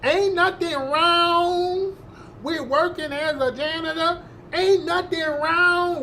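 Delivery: high, slow, drawn-out mock Southern accent.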